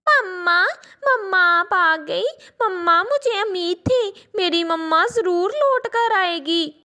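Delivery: a daughter thrilled her mother is back; overjoyed child. Acted. Daughter sees mother return.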